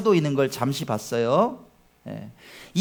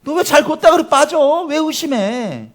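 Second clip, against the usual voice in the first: higher, louder, then slow questioning; Jesus rebuking; 9 semitones higher, 4 dB louder than usual. Acted. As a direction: scold the sinking disciple. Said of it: "주님 그렇게 말씀하셨어요".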